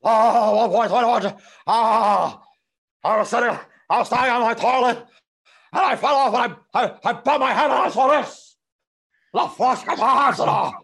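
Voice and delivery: put-on high, loud, bewildered teen; panicked, laughing bewilderment; confused Marty.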